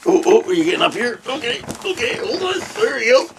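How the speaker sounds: high, coaxing talk to a dog; pet talk. Real, not acted. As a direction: fuss over the dog in a very high voice. Dog climbing onto lap.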